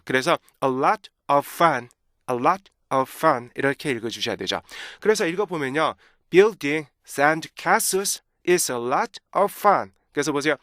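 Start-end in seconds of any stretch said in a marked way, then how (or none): 0.6-3.5 A LOT, pause, OF FUN; break before OF. Shown as a demonstration. Teacher modelling phrasing, pause between LOT and OF. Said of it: "반드시 끊어주시는 게 좋아요". 6.3-9.9 slow reading with breaks between phrases; cut into chunks. Shown as a demonstration. Teacher reading by marked breaks, slow and chopped. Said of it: "잘라드린 대로 한번 읽어보도록".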